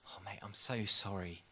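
hushed, whispered sympathy; pitying whisper. Retold or imitated. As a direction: breathe it out like a friend's pity. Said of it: "friendly look of, of pity".

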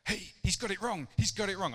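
high, loud indignant protest; scandalised scribes crying foul; mock Pharisee outcry. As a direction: play the outraged Pharisees objecting. Imagined Pharisees objecting.

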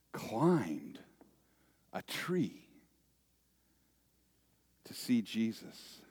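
hushed storyteller's whisper on the climb; dramatic whisper. Acted. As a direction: drop to a whisper to build suspense. Preacher hushes at dramatic moment.

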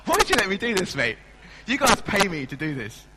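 loud, high playful protest; mock exasperated. Real, not acted. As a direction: tease the tech guy with a big mock complaint. Jokey complaint to sound man.

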